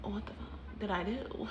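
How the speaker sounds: soft shocked mutter; hushed panic; muttered under breath. Retold or imitated. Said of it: "And so I'm like".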